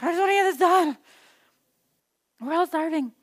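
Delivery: mock a mother who just wants it done; impatient mama. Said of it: "a good mama that's not impatient".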